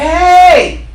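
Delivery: loud high call across the gulf; hollering. Retold or imitated. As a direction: holler it up to heaven. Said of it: "He hollering".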